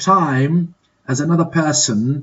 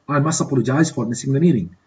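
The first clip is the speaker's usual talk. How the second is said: rattled off fast in one breath; quick repeat; much faster than usual. Shown as a demonstration. Teacher repeating the apology for students, fast. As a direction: run the apology together quickly, like natural speech. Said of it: "Complete these dialogues in a suitable way".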